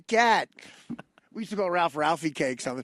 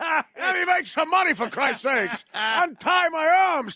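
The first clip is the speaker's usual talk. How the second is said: mock Tony Soprano; 8 semitones higher, slower than usual. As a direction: yell it like a frustrated gangster. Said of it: "That's all Tony Soprano needs".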